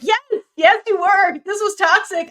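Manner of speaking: high, loud, exaggerated yes; mock emphatic yell. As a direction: overplay the agreement loudly. Retold emphatic agreement.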